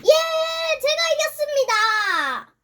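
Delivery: loud, high victory cheer; triumphant. Real, not acted. Player announcing a win, triumphant. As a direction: crow it out, drawn out and loud.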